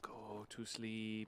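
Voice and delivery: slow, soft lulling voice; soothing, slow. Pretend. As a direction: draw it out gently, very slowly. Soothing someone into sleep.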